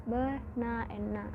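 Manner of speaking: "be, na, and na" said as three separate beats; syllable by syllable. Shown as a demonstration. Student teaching the class, splitting "be, na, and na" into separate syllables. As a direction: space out each syllable of banana. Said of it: "Banana has three syllables".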